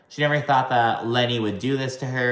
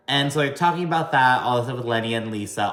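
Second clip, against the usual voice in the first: ordinary delivery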